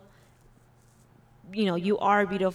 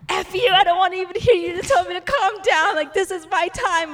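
much higher, louder and fast, hammed-up angry rant; mock furious husband; 10 semitones higher, 8 dB louder than usual. Wife imitating husband snapping at her, hammed-up furious rant. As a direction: blow up at being told to calm down. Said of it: "I never said "F you".

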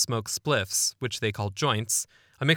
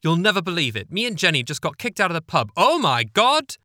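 excited younger sister; 6 semitones higher, 6 dB louder than usual. Retold or imitated. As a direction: burst in gushing about the news. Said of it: "came a woman's voice, not his mother's, younger".

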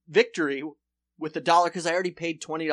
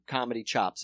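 ordinary talk

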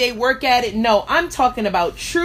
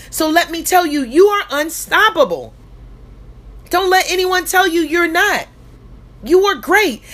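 rally the crowd to believe; booming motivational speech; 6 semitones higher than usual. Acted. Rising motivational peak.

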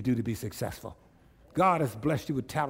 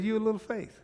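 Jesus voice; 8 semitones higher than usual. Retold or imitated. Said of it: "Jesus said".

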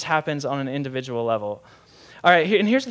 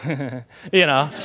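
imitated kid's stifled giggle; mischievous giggle. Boy who pranked his sleeping grandpa, imitated sneaky giggle. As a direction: giggle under your breath, half-suppressed. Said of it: "just doing my giggle".

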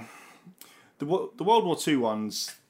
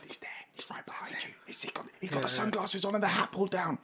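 urgent whisper; 8 semitones higher, 5 dB quieter than usual. Retold or imitated. Friend whispering about celebrity.

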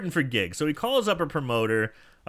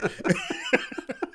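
very high mock villain cackle; evil cackle; 3 dB quieter than usual. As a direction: cackle like a cartoon maniac. Villain laugh.

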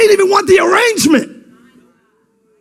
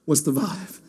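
low, soft, laid-back groove voice; seventies cool cat; 14 dB quieter than usual. Acted. Preacher jokes in seventies slang, low and soft. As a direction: purr it like a seventies smoothie. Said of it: "a very seventies way of saying".

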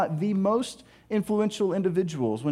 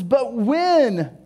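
"when" leaned on, higher than usual; stress on when; 4 semitones higher, 4 dB louder than usual. Acted. Jesus sending disciples off, stress on "when". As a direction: land firmly on "when" and stop. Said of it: "you said when".

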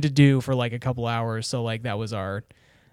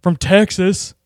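louder, higher Texan voice; Stone Cold Steve Austin; 5 semitones higher, 6 dB louder than usual. Shown as a demonstration. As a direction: do a loud Texas wrestler impression. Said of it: "I could do a Stone Cold Steve Austin".

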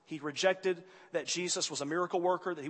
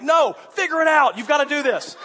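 insistent demand; 8 semitones higher, 9 dB louder than usual. Retold or imitated. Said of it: "I'm like".

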